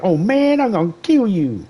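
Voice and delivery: high, mock menacing game-voice parody; imitating a game character. Streamer mimics a game character threatening him, high and hammed-up. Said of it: "He's like".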